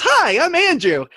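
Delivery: high, slow, loud friendly hello; cheery greeting. Retold or imitated. New roommate introducing himself at the door, high and loud. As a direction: greet the stranger brightly and slowly. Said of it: "this guy shows up at the door and goes".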